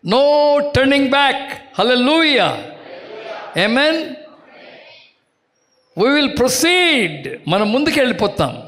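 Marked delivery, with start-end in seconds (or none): 0.1-7.4 high, slow declaration; slow, ringing pulpit declaration; preaching proclamation. Acted. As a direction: drag each word out like a battle cry. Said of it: "preacher proclaiming slowly".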